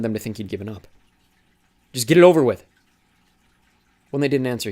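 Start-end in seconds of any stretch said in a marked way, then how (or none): 1.9-2.7 loud, forced, bitter; louder, push it out through the hurt; angry outburst. Acted. Thomas repeating his demand to the traitors, loud and angry.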